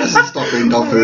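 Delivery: laughing with a full mouth; chewing. Real, not acted. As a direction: talk through the chewing. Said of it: "I can't believe you're chewing it".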